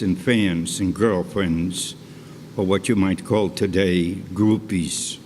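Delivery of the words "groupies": ordinary delivery, nothing marked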